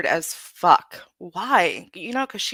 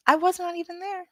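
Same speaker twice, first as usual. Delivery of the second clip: "I was not even there" repeated higher and disbelieving; incredulous repeat; 9 semitones higher, faster than usual. Real, not acted. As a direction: pitch the whole line up in disbelief.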